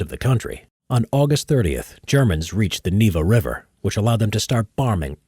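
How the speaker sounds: measured history narrator read; documentary narration. Acted. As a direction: read it like a war documentary voice-over.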